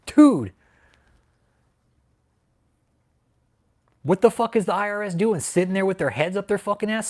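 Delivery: shouting in disbelief at an idle agency; furious rant. Real, not acted. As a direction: vent full fury at the IRS. Ranting at the IRS.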